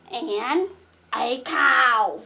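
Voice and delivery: ordinary talk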